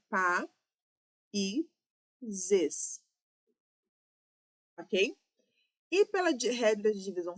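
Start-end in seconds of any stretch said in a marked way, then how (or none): 0.1-3.0 "pa, i, zes" as three separate syllables with long pauses. Shown as a demonstration. Teacher showing students the syllable division, "pa, i, zes" said syllable by syllable. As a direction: break it into "pa", "i", "zes", one beat each. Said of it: "observe que a divisão silábica é".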